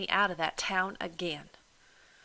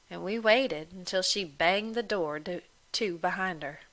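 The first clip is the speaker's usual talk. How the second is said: ordinary delivery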